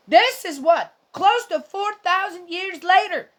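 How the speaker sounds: preacher-style exasperated outburst; impassioned near-yell. Real, not acted. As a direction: hammer it home with rising outrage. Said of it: "I know I'm not yelling at you".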